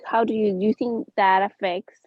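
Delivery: ordinary talk, nothing marked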